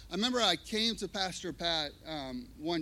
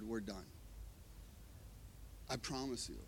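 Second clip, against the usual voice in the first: quiet close; 7 semitones lower, 14 dB quieter than usual. Retold or imitated. Pastor quietly ending meeting.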